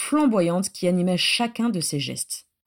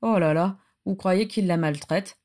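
ordinary delivery

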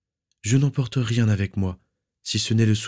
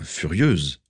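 plain reading voice

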